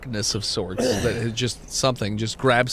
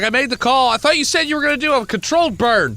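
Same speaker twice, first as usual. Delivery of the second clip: high, loud exasperated sheriff; 11 semitones higher, 6 dB louder than usual. Acted. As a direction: complain in a raised sheriff voice. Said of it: "He's like".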